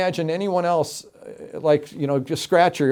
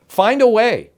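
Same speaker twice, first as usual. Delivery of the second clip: normal talking voice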